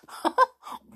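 high amused little laugh from the mistress. Acted. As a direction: laugh kindly at the cheeky girl. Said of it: "and she laughed".

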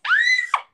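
very high, loud shriek of surprise; surprised shriek. Retold or imitated. Girl spotting the lost turtle's head, shrieking. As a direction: let out a sudden piercing scream. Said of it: "and I was like, shout".

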